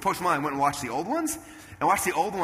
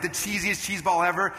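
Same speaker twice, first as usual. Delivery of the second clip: ordinary talk, nothing marked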